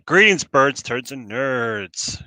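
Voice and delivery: big drawn-out welcome; loud greeting. Real, not acted. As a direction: belt out the greeting. Stream opening greeting to viewers.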